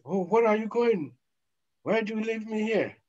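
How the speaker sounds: high, begging wail; high pleading cry. Acted. Dead person begging mourners to stay, high crying plea. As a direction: beg them not to leave, almost crying.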